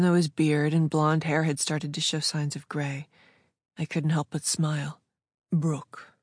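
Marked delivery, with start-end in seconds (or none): 5.5-6.1 put-on a man's slight German accent. A narrator's reading. Jacob greeting a regular customer, slight German accent. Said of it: "in his slight German accent".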